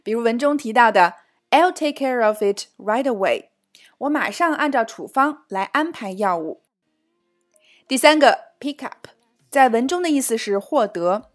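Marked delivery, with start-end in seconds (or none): none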